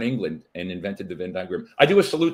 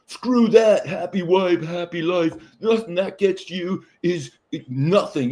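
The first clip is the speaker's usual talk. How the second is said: higher, louder mock angry guy; angry men; 7 semitones higher, 3 dB louder, slower than usual. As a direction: ham up a riled-up husband. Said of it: "angry men are calling me going".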